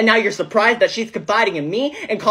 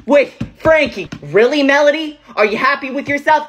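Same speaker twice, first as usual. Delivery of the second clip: angry confrontation; 5 semitones higher, 4 dB louder than usual. Acted. Confronting Melody at the party.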